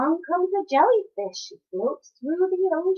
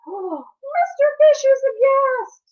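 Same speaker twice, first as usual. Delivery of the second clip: high, loud and slow astonishment; aghast narration; 11 semitones higher, 8 dB louder, slower than usual. A narrator's reading. Reading to children, the fish's shock drawn out high and loud. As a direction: read it wide-eyed with shock.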